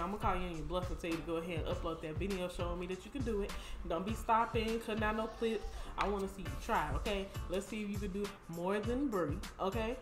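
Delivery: ordinary talk, nothing marked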